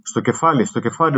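mild yell, repeated with rising urgency; narrated howl. A narrator's reading. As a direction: egg on a beating, short of screaming. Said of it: "ουρλιάζει ο Καραφώτης".

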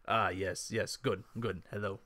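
low-pitched adventurer's greeting; Kran's voice. Acted. Game master voices Kran replying.

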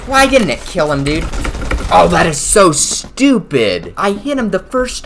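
loud, high complaint of real frustration; frustrated yell. Real, not acted. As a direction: vent at the gun, slowing on the last words. Gun failed to kill.

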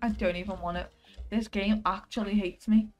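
ordinary talk, nothing marked

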